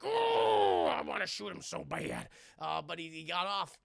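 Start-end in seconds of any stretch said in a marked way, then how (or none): 0.0-2.3 squeaky whine, then a voice unlike his own; frustrated Batman. Retold or imitated. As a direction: Batman pouting about the one that got away. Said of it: "And he's like".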